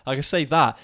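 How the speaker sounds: ordinary talk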